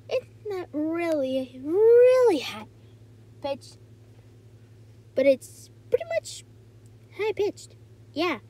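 very high-pitched character voice; Golden Ingot. Shown as a demonstration. Demonstrating a cartoon character's voice, very high-pitched. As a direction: push the voice way up high, stretching words. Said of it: "Golden Inget's voice".

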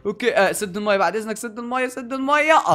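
usual speaking voice

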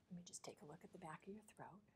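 hushed whisper. Real, not acted. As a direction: whisper it close and soft. Quiet aside before throat check.

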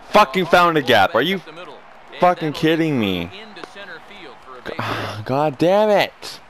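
disbelieving fury at the screen; angry outburst. Real, not acted. Hit drops in, streamer furious.

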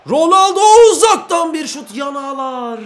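high loud long-shot call; excited call. Real, not acted. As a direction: commentate the strike loud.

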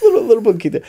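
high, giggly "little poquito"; laughing cutesy poquito. Acted. Playing up the joke for a friend, giggly cutesy. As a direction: squeak it out through a laugh.

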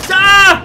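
very loud, high scream; panicked scream. Real, not acted. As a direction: scream as you get caught. Killed by the hunter.

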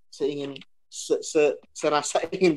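laughing while holding back harsh words; stifle a laugh mid-sentence; amused, half-laughing admission. Real, not acted.

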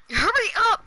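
high, loud urging; impatient shout. Real, not acted. Hurrying Jaden to play duos, impatient shout. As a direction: shout to hurry a friend along.

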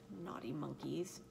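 ordinary delivery, nothing marked

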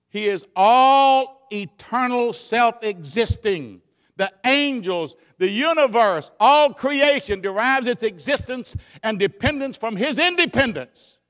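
loud, drawn-out preaching climax; preaching at full force. Acted. Preacher proclaiming God's greatness to the church, full force. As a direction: thunder it out to the congregation.

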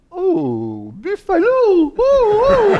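very high, loud hooting mock delight; hammed-up high-pitched oohing; gushing socialite. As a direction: swoop into a falsetto coo, hamming up her reaction. Mimics the woman's gushing reaction.

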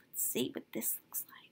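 quick hushed whisper. Real, not acted. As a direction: whisper it to yourself. Whispered aside.

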